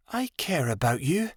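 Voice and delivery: mildly weak plea; weakly. A narrator's reading. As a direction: say it weakly, half giving up. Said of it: "she told him weakly".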